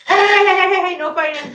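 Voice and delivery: loud, high calls to break up a fight; 1 loud hey. Real, not acted. Streamer breaking up two fighting cats, loud and high. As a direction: cut in loudly to stop the cats fighting.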